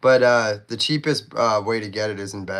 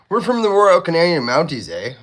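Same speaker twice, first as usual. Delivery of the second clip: loud, higher-pitched Canadian caricature; 8 semitones higher, 9 dB louder than usual. Acted. Mocking how people picture Canadians, hammed-up Canadian. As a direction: put on a hearty Mountie's Canadian accent. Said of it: "when people picture Canadians, they, they picture".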